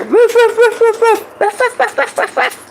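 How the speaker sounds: very high playful barking; high dog woofs. Acted. Dog chasing ducks in the pond, yapping. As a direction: yap like an excited puppy.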